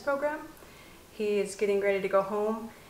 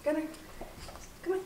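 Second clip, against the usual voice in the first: ordinary talk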